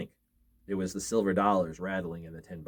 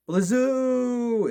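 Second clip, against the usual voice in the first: high, loud ghostly wind howl; eerie wind whoosh; 13 semitones higher, 6 dB louder than usual. A narrator's reading. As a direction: blow it like a storm wind through the chimney. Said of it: "it went".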